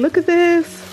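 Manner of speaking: ordinary talk, nothing marked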